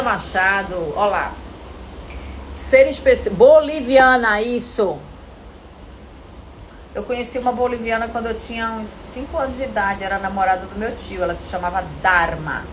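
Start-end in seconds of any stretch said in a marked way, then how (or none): none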